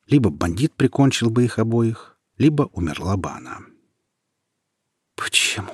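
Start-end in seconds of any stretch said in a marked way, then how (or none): 5.2-5.7 faint, breathless whisper; dying whisper. A narrator's reading. Dying Nika asks why, faint whisper. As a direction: ask with the last breath. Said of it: "прошептала Ника".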